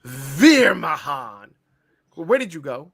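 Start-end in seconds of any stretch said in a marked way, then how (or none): 0.0-1.5 loud, drawn-out wrestler introduction; booming, hammed-up announcement; mock ring announcer. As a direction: stretch the name like a ring announcer.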